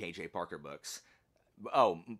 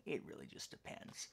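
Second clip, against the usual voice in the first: breathy under-the-breath aside, much softer; whispered aside; 8 semitones lower, 13 dB quieter than usual. Real, not acted. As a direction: whisper it as a throwaway afterthought. Muttered aside to herself.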